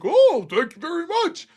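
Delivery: a hint of a thankful old man; grateful old man. Old sample man thanking the buyer, lightly voiced. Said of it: "He's like".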